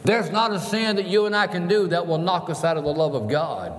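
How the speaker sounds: climbing, emphatic pulpit declaration; impassioned preaching. Acted. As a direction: proclaim it to the whole church. Preaches at full force.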